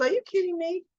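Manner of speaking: high, incredulous are you kidding me; mock indignant disbelief. A mother rejecting pity about her son, high and incredulous. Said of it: "She goes".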